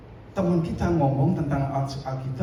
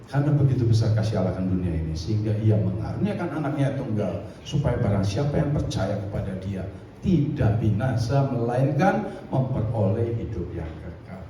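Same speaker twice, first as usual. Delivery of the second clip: lower, quick recital of a known verse; scripture recitation; 6 semitones lower than usual. Acted. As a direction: rattle off the familiar verse. Recites John 3:16 from memory. (membaca ayat dengan cepat dan rendah)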